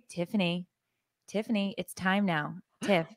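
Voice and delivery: soft, low coaxing; hushed, patient nudge; gentle wake-up call. Retold or imitated. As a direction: softly try to rouse a sleeper. Acts out gentle wake-up calls.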